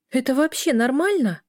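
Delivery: high-pitched indignant question. A narrator's reading. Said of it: "возмутилась Эля".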